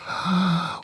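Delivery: sharp, high intake of breath; mock gasp. Comic stunned by the Toyota summer event, mock gasp. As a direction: gasp as if scandalized.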